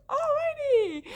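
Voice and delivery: high, loud sing-song alrighty; mock cheerful. As he comes to her platform, high bright mock cheer. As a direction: chirp it with forced brightness.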